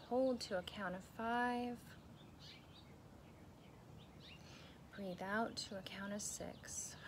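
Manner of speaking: slow, soft breathing guide with long pauses; guided meditation. Acted. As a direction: ease the listener into calm, one breath at a time.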